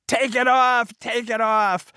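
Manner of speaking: high repeated screaming demand; retold movie scream. Father in a film screams at the screen, high repeated scream. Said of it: "and he's screaming".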